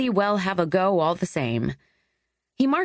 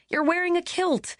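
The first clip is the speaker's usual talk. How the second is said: much higher, pleased with herself, stressing wearing; triumphant; 13 semitones higher than usual. Acted. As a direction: point out the kilt with glee. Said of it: "she said triumphantly".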